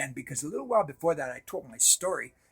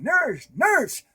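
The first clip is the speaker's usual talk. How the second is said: high, loud call for the nurse; mother calling for help against a stranger; imitated yelling; 12 semitones higher, 7 dB louder than usual. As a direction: call out in alarm. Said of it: "she started yelling".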